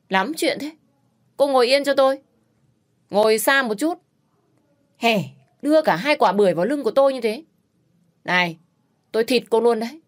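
high, loud gruff telling-off; rude scolding. A narrator's reading. Rude rider tells the girl behind him to sit away, angry. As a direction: shove her away with sharp words.

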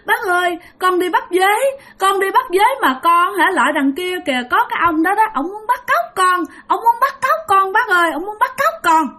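put-on high pleading child's voice; frightened child telling his uncle, high and tearful; crying child. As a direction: beg the uncle like a scared crying boy. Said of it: "ba vừa khóc mà vừa nói".

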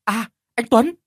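high, loud relieved exclamation; joyful cry. Acted. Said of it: "Tâm đã liền reo lên". (reo lên mừng rỡ)

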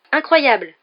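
beaucoup plus aigu et fort, émerveillé; éclate d'enthousiasme; exclamation ravie. Acted. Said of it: "s'exclama Seamus". (Seamus félicite ses camarades, exclamation rayonnante)

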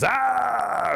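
sharp high yelp as fur rips; high scream of pain. Acted. Rabbit rips fur off his belly, high yelping scream. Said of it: "and he screams".

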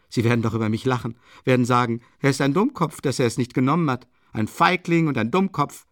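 loud, quickened mockery; mock their verdict, pitching the insults up; scornful. A narrator's reading. Murderer imagining people laughing at him, scornful. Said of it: "mit einem höhnischen Lächeln".

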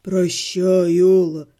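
low, mournful parting; low mournful half-gnome; tragic farewell. A narrator's reading. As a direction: say goodbye as if forever.

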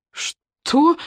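высокий короткий вскрик от шока; почти вскрикнуть от ужаса; испуганный вскрик. Acted. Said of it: "чуть не вскрикнула Алена". (Алена в шоке едва не вскрикивает)

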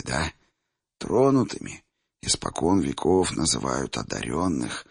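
put-on low, quiet old seer; old man voice. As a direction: speak softly as an old man. Old seer Chimir speaks.

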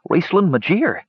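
understated distress; low, shaking voice. A narrator's reading. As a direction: insist on it while badly shocked. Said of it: "in a low, shaking voice".

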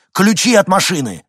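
loud, high, rasping demand; hoarse yell. A narrator's reading. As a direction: bellow it hoarsely, urgently. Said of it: "хрипло заорал Винсент". (хриплый ор)

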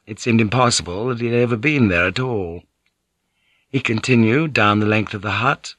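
plain reading voice

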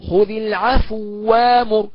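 slow chanted خذ العفو وأمر, the two waws merged into one doubled sound; full merging recitation. Shown as a demonstration. Teacher reciting the full-merge reading for students. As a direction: recite slowly, merging the waw completely. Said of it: "تقرأ هكذا".

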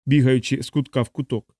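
ordinary delivery

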